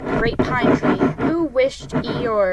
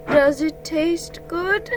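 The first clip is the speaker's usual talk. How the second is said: hungry Pooh voice; 6 semitones higher, slower than usual. A narrator's reading. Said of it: "Pooh held his empty tummy".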